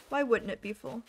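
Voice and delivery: ordinary delivery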